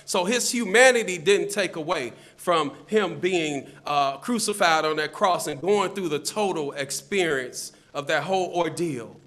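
ordinary delivery

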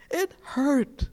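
high, ringing cry of pain; anguished cry. Real, not acted. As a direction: let it ring out high. Preaching peak.

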